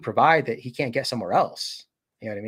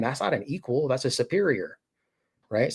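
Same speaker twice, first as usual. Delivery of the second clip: ordinary talk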